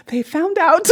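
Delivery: high, loud mock panic with a laugh; a kid caught out, half laughing; mock alarm. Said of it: "I'm like".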